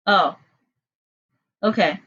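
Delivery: ordinary talk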